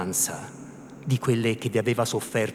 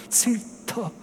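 soft, high, irate hiss for silence; low-voiced anger; 11 semitones higher, 5 dB quieter than usual. A narrator's reading. Priest silencing his housekeeper, hushed and irate. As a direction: shush her angrily without being overheard. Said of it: "rispose con voce bassa ma iraconda".

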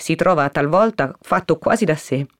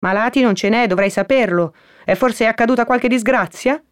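higher-pitched housekeeper questioning visitors; Perpetua; 6 semitones higher than usual. Acted. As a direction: question them from the window in a raised pitch. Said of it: "Era la voce di Perpetua". (voce acuta di domestica)

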